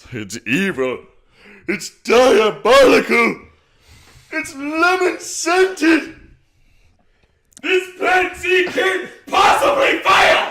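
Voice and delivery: Plankton evil scheme. Shown as a demonstration. Voice tutor demoing an enraged gloating Plankton. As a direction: stretch every word in mad triumph. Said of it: "stretch out your words".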